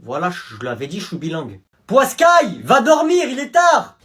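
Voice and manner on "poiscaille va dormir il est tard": higher, louder bedtime order; scolding parent. Acted. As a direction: order the kid to bed.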